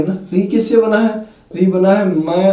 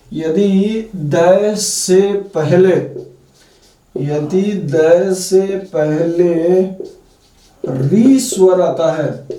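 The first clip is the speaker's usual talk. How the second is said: very slow, word by word; slow dictation of a grammar rule; much slower than usual. Shown as a demonstration. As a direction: dictate the rule slowly for writing. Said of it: "वो मैं special note पहले लिखाऊंगा".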